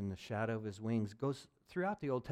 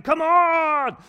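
loud, high push; mock rallying shout; 17 dB louder than usual. Preacher mocking people who keep pushing, loud high shout. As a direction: shout like you're forcing it through.

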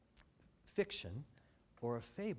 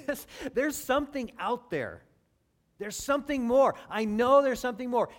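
higher and louder, sure of it; excited conviction; 8 semitones higher, 11 dB louder than usual. Acted. Preacher voicing Columbus certain, loud and excited. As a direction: let Columbus burst out with certainty.

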